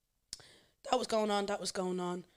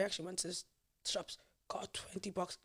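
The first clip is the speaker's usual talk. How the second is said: whispered aside; 9 dB quieter than usual. Real, not acted. Confessing the cigarette purchase.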